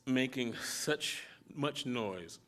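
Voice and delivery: usual speaking voice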